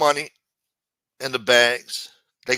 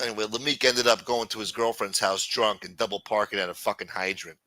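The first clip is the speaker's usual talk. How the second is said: ordinary talk, nothing marked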